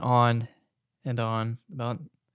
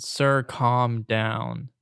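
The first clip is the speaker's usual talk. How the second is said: very slow, drawn-out words; slow, deliberate retold command; firm order; 4 dB louder, much slower than usual. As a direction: stretch each word to settle him down. Retold firm order to angry customer.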